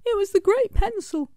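high excited little smudge cry; cried out as Smudge. A narrator's reading. Smudge crying out to Scribble about the pencil, high and urgent. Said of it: "cried a little smudge".

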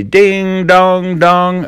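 high sung ding dong bell; bell sound. Acted. Ben playing the riverboat bell, high sung chime. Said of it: "he would shout again and say".

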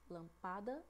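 lampada stressed on PA; wrongly stressed lampada, lam-PA-da; stress on middle syllable. Shown as a demonstration. Teacher offering a wrong option, lampada stressed on PA. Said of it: "Vocês observaram a diferença?".

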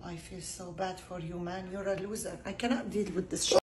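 quiet disgust. Real, not acted. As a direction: mutter it, disappointed. Unimpressed by bad joke.

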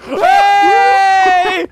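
high panicked yell; panicked shout. Real, not acted. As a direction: shout in alarm. Being carried off.

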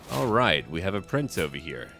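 usual speaking voice

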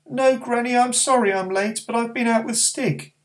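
put-on high, eager boy's voice; young boy calling to his friend; young boy Barney. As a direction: speak up as a small boy.

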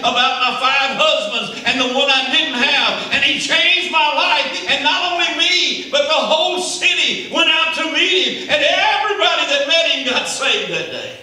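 loud, high testimony cry; preaching at full force. Acted. Preacher crying out the woman's testimony to the church. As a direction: preach it out like a joyful witness.

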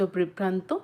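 ordinary delivery

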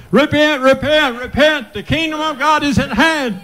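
John the Baptist shouting from the river; fiery call to repent. Acted. Preacher cries out repentance like John at the Jordan.

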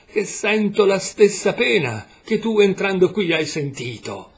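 a pained portrait crying out its grief; desperate sadness. A narrator's reading. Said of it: "con la disperata tristezza degli occhi".

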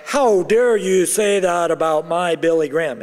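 loud, hammed-up outrage; imitated affronted scolding; mock indignation. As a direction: play an offended admirer, loudly. Acts indignant reply never given.

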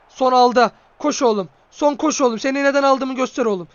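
loud, driving cheer for the striker; urging shout. Real, not acted. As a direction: push your player on, loud. Urging his player on.

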